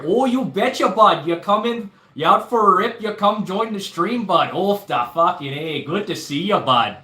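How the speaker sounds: playful over-the-top Canadian greeting, eh; Canadian accent. Retold or imitated. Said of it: "That's the best Canadian accent I got".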